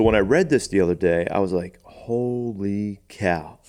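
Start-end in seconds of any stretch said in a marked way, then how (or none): 1.8-3.6 slow, drawn-out astonishment; retold amazement. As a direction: stretch it out in stunned wonder. Retelling own astonishment at reading it.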